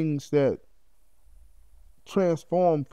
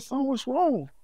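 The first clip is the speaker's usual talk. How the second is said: frightened mother; 10 semitones higher, slower than usual. Retold or imitated. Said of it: "she kinda frightened".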